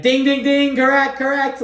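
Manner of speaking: high, loud mock quiz-show ding; game show host. Mock game-show host calling out the right answer, high and loud.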